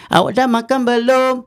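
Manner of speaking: "Awak dah makan belum?" high, stress on dah; odd intonation. Shown as a demonstration. Teacher shows confusing intonation. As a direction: lift and hit dah oddly. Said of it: "tak faham".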